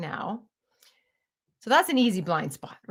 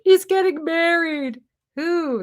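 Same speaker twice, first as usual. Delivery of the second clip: Sally upset; 8 semitones higher, 5 dB louder than usual. Retold or imitated. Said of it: "he's like".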